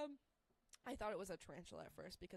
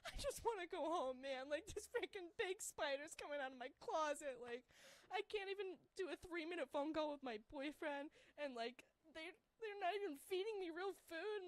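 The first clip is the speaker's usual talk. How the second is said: near tears; 7 semitones higher than usual. Retold or imitated. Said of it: "on the verge of tears".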